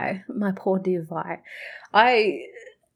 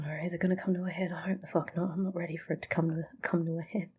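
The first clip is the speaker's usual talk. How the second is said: whispering; 11 dB quieter, faster than usual. Real, not acted. Dreading the coming showdown.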